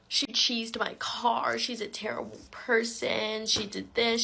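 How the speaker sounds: high, drawn-out mock tattling; mocking imitation. Mimicking the lying online accusations.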